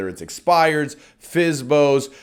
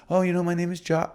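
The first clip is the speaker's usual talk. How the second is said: quick, softer, unsure introduction; timid name; 3 semitones higher, 4 dB quieter, faster than usual. Shown as a demonstration. Coach mocking a timid introduction, quick and soft. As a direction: mumble your name apologetically. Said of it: "a doctor that says their name with just".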